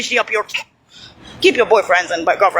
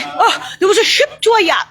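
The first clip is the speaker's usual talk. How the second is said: higher, half-laughing mock of their excuse; mocking quote; 7 semitones higher than usual. Mocking what critics say, higher and scornful. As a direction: parrot them in a higher, scoffing voice. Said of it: "And then they say".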